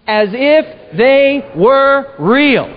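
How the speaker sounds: drawn-out, very slow, every word weighed; slow read-along. Shown as a demonstration. Preacher pulling the congregation into the quote, very slow. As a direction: lead the room through it slowly. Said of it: "read this next line with me".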